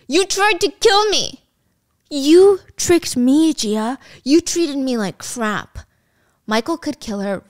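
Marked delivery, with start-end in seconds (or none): 0.1-5.8 slow, drawn-out mock fury; acted accusation. Attacker confronting the woman who tricked him, dramatic accusation. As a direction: stretch each accusing word, pitched up.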